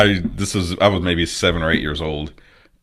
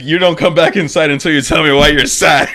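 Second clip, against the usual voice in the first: loud, high imitation of dad; mock stern order; 9 semitones higher, 5 dB louder than usual. Guest imitating his dad sending him outside, loud mock order. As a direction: raise pitch and volume, replaying a parent's ultimatum.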